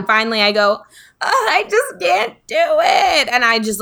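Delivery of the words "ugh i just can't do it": put-on high, whiny little-kid wail, slow; frustrated crying child. Small child to her dad, giving up in tears. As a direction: whine it like a four-year-old giving up. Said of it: "start crying".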